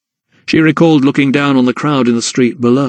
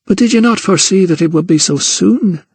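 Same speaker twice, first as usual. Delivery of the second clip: plaintive; 7 semitones higher than usual. Acted. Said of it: "Her voice was plaintive".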